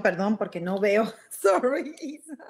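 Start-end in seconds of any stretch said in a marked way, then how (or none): 1.2-2.4 laughing. Real, not acted. Laughs at own missing glasses.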